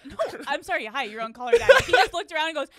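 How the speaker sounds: can barely speak for laughing. Real, not acted. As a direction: talk through loud laughter. Host cracking up at guest.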